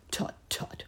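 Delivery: whispered tut tut; a hushed scolding click. A narrator's reading. Uncle Wiggily scolding the piglets softly. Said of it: "scolded Uncle Wiggily".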